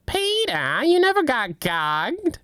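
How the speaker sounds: lightly voiced Lois telling him off; Lois impression. Wife scolds Peter in a high slow voice. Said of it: "I can't do any of those".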